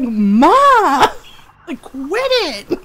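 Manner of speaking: loud drawn-out mock protest; exasperated daughter. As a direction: imitate an exasperated daughter wailing at her mom.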